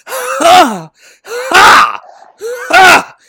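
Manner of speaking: loud, high shouted grunts with each swing; loud battle grunts. Retold or imitated. Peter grunting with every sword swing, loud and high. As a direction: bellow each Ha like a clumsy swordsman. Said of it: "loudest worst sword fighter".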